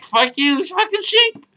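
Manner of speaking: high-pitched mock rant; retold cursing outburst. Player retelling cursing at opponent, high mock outburst. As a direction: curse in a hammed-up squeal. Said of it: "I'm gonna start cursing".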